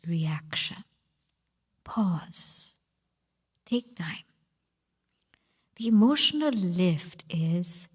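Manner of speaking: hushed meditative teaching; intimate whisper. Acted. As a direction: lean in and whisper the lesson. Whispered guidance lines.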